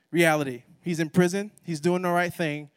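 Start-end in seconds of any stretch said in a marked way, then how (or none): none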